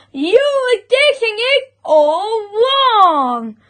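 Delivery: high-pitched scolding hens; scolding chickens. A narrator's reading.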